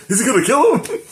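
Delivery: giddy, laughing impression of the eager warrior; excited Thorkel. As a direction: squeal it like a kid who can't wait for a fight. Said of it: "He gets excited about it".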